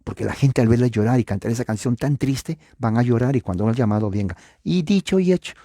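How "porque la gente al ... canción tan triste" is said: usual speaking voice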